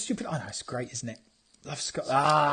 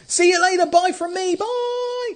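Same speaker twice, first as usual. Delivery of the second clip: sing-song goodbye; 10 semitones higher than usual. Real, not acted. Sign-off to Periscope viewers.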